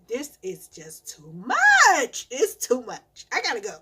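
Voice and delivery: overwhelmed mom fed up, heading outside; exasperated. Retold or imitated. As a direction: drag it out like you're done. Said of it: "I said".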